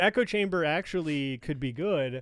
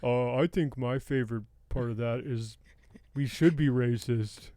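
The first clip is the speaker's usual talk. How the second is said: Juan impression; 5 semitones lower than usual. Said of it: "that's my Juan".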